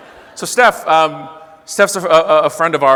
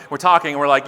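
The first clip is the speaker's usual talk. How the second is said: ordinary talk, nothing marked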